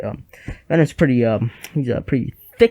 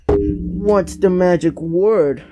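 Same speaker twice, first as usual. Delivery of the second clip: drawn-out, higher-pitched quote; mock coaxing demand; 4 semitones higher, much slower than usual. Reviewer quotes Jameson at Spider-Man, slow mock coaxing. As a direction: stretch each word out slowly, pitched up.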